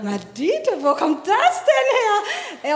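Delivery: high, loud, slow shocked question; as if the scream came from nowhere; shocked surprise. Retold or imitated. Erschrockene Mutter über Schrei. (hoch, laut und langsam, fassungslos überrascht)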